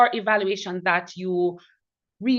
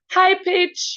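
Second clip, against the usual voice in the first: HIGH PITCH raised high and louder; 12 semitones higher, 6 dB louder than usual. Retold or imitated. Evaluator echoing a speaker's demonstration, high-pitched. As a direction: lift the words high pitch sharply up in pitch. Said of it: "demonstrated it in how you said".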